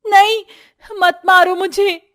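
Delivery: high, loud cry of terror; screaming. A narrator's reading. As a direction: scream awake from a nightmare. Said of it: "जोर से चिल्लाते हुए".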